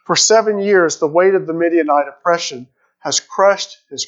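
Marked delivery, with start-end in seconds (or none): none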